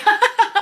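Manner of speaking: high burst of laughter after a bump; embarrassed laugh. Real, not acted. Laughs after hurting herself.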